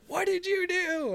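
high, slow, aghast cry; the whole party gasping at what he broke; shocked disbelief. Retold or imitated. Party members reacting to the broken artifact, high and aghast. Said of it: "everyone was like".

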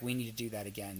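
hushed and drained; softly, after a long cry; quiet and soft. Retold or imitated.

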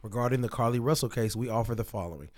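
formal statement read aloud. Real, not acted. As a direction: read it like a press release. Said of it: "reads spa statement aloud".

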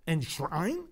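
scornful questioning enshrine rising; incredulous echo. Acted. Priest mocking activists' word to congregation, incredulous. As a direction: repeat the word in disbelief. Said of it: "They use the word enshrine".

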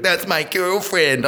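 high, drawn-out mock indignation; mock upset. Dentist confronting workmate about his girlfriend, mock upset. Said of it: "he got all upset".

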